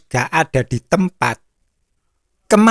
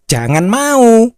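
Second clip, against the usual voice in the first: emphatic warning; 7 semitones higher, 4 dB louder than usual. Real, not acted. Preacher warning congregation.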